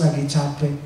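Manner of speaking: ordinary delivery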